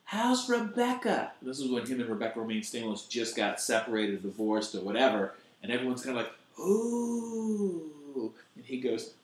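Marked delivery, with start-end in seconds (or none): 0.1-1.3 mock heckler yell. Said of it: "this girl yells out". 6.6-8.3 mock crowd ooh. Crowd reaction voiced.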